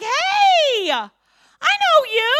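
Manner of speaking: bright recognition of a friend; excited greeting. Retold or imitated. Someone recognizing you in public, high and excited. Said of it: "they're like".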